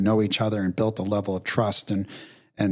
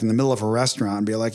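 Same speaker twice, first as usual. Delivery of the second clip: normal talking voice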